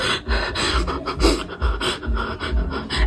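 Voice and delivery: long run of quick, wordless breaths; put-on panicky panting; fake panic breathing. Shown as a demonstration. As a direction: pretend to hyperventilate for attention. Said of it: "breathe loudly".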